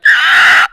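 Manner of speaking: loud, high lunging yelp; imitated dog snarl. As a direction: bark it like the dog in your face. Dog lunges at his face.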